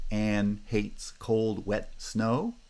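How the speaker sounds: Anne hates cold, wet snow, slow with every word stressed; slow, separate beat on each word of Anne hates cold, wet snow; each syllable stressed. Shown as a demonstration. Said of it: "Each of those syllables is a foot".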